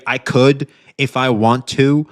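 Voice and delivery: normal talking voice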